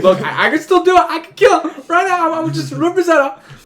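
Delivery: high, loud, over-the-top movie threat; movie tough guy. Acted. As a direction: ham up a big-screen killer's boast. Mimics movie villain holding a head.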